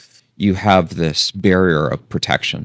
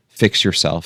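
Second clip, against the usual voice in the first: ordinary talk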